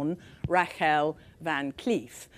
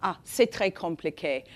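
imitating a French woman, French accent; lightly voiced French woman, sighing. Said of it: "They would always say to me".

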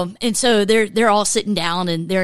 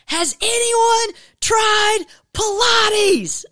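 much higher, slow mock scream of a board sentence; imitating a scream; 13 semitones higher, much slower than usual. Doctor Rick yelling the board sentence at his class, mock scream. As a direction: scream it like words written in all caps. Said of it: "But he screams".

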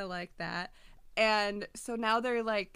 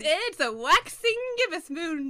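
mock mystic; 7 semitones higher, 9 dB louder than usual. Dramatic astrology pronouncement.

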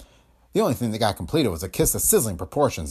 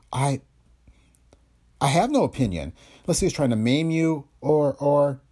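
lightly voiced woman, higher and halting; evasive woman character; 5 semitones higher than usual. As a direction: stall through a hesitant denial in a light female role.

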